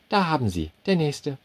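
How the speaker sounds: high, brisk waitress brushing him off; curt waitress. A narrator's reading. Waitress waving off the customer, high and curt. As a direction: call the next one in a high voice.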